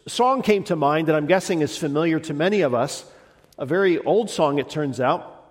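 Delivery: ordinary talk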